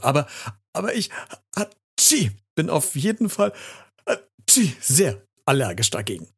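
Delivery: high, halting words broken by sneezes; sneezing. A narrator's reading. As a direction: fight the sneeze and lose it mid-sentence. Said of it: "warum ich so niesen muss".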